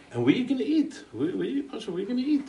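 mock-anxious questions, voice jumping up; retold flustered worry. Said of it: "He got all fateisos".

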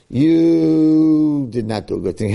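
acted rebuke with a long drawn-out first word; slow, emphatic mock reprimand; stern rebuke. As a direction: hold the opening word, then scold. Shmuel acted rebuking Shaul.

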